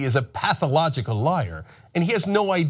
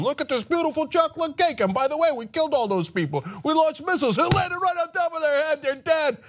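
mock boastful president; 11 semitones higher than usual. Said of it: "the goofball is like".

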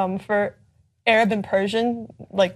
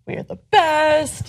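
drawn-out, higher proud imitation; slow, raised-pitch mock pride; mock boasting; 6 semitones higher, much slower than usual. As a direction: stretch it out like a national boast. Mimics nationalist boasting.

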